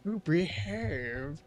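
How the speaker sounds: slow, drawn-out Austin Powers; a lightly voiced Austin Powers drawl; Austin Powers impression. Gamer repeats the Austin Powers catchphrase, slow and drawn out. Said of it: "And still her wife".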